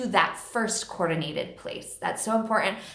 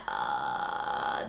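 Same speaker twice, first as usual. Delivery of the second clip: vocal fry; 5 dB quieter than usual. Shown as a demonstration. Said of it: "once you find that".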